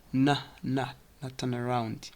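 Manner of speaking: softly said "na" for now; reduced "now". Shown as a demonstration. Teacher repeating the reduction, soft short "na". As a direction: shorten "now" to "na", leading into "turn around". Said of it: "now reduces".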